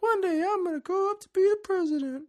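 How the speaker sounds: very high-pitched pretend child; little kid voice. Podcaster voices a kid in Washington dreaming aloud, squeaky child voice. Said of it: "there's a kid in Washington".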